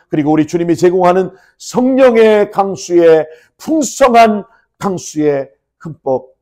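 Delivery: high-pitched, surging pulpit delivery; impassioned preaching. Real, not acted. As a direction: proclaim a blessing with force. Preaches at full force.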